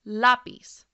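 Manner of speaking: Lápiz punched loud on LÁ, the second-to-last syllable; stress on first syllable. Shown as a demonstration. Teacher asking learners to listen closely, Lápiz stressed loudly on LÁ. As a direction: hit the LÁ of Lápiz hard, as a clear model. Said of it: "that stress is on the second to last syllable".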